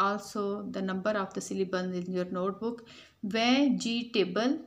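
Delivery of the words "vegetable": "Vegetable" in three separated beats; syllable by syllable. Shown as a demonstration. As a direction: break "Vegetable" into ve-ge-table. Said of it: "divide the given word into their syllables". ("Vegetable" को तीन हिस्सों में तोड़कर)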